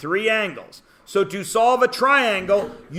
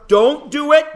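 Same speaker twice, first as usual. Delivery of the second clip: sharp warning; 6 semitones higher, 5 dB louder than usual. Real, not acted. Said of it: "teacher stops class".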